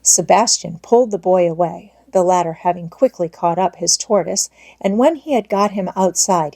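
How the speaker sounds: ordinary delivery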